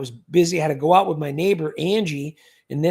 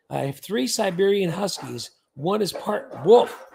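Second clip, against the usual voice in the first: ordinary talk, nothing marked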